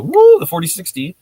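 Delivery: loud, high whoop of delight; excited woo. Real, not acted. Host hearing a viewer's printer is on the way, loud excited whoop.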